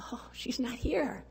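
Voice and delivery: put-on slow, hushed little-girl whisper; tearful whisper. Five-year-old whispering tearfully about her absent mother. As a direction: let it fall like a disappointed child. Crying child at empty seat.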